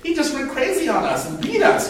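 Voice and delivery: put-on high, whiny tattling child; whiny tattling kid. Matthew tattling to the teacher, in a whiny high voice.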